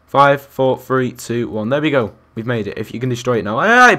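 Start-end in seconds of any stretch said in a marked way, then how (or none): 3.6-3.9 loud, high cry while falling; startled yell. Real, not acted. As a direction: cry out as you get pushed. Pushed off the blocks in game.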